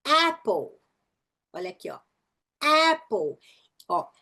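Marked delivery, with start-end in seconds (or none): 0.0-0.8 "Apple" with A mouth and E sound; exaggerated ae in "Apple"; short A. Shown as a demonstration. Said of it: "boca de A e som de E". 2.6-3.4 "Apple" with A mouth and E sound; exaggerated ae in "Apple"; short A. Shown as a demonstration. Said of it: "boca de A e som de E".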